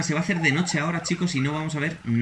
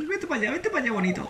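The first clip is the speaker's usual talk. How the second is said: coaxing; 12 semitones higher than usual. Real, not acted. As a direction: sweet-talk the beast into leaving. Coaxing the predator away.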